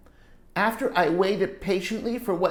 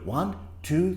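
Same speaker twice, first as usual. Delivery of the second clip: ordinary delivery, nothing marked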